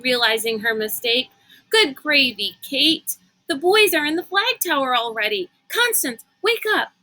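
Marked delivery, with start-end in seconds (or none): 1.7-6.9 high, fast and urgent; urgent excitement. Acted. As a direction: rouse her, no time to lose. Kate urgently waking Constance.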